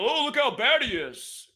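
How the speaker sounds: high sneering troll voice; mocking troll. Mocking the trolls who clip his videos, high sneer. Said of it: "my trolls will clip that and be".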